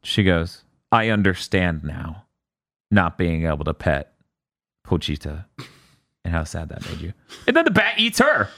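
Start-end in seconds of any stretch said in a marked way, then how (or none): 0.9-7.1 low, softening sad voice; sad realisation. Acted. She thinks about the stolen cat, sad realisation. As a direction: let it sink lower and quieter, with pauses.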